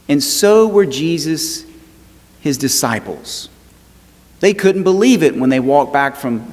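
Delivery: rising preacher's cadence with long pauses; a pastor turning a wedding story to Jesus, preaching with building fervour. Acted. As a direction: preach it, lifting the congregation.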